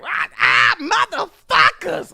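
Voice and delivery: very loud, high, drawn-out scream; screamed curse. Retold or imitated. Driver screaming after the operator hangs up, furious. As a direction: scream it, losing your mind. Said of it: "I was fucking losing it".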